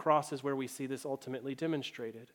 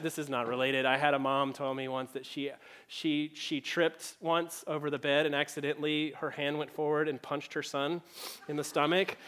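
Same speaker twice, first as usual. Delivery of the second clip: ordinary delivery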